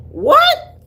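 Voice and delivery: squeaky, loud shocked what; high, loud disbelieving what. Retold or imitated. Baffled by country words.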